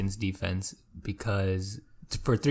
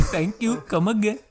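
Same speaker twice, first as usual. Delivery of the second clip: high-pitched Indian shopkeeper caricature; singsong, exaggerated Indian accent; mock Indian accent; 10 semitones higher than usual. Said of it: "always talking like this".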